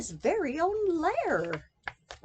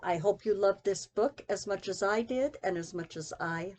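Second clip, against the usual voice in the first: tender; 7 semitones lower, 6 dB quieter, faster than usual. Real, not acted. Tender closing to child.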